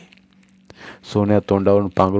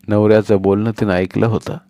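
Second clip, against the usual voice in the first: ordinary delivery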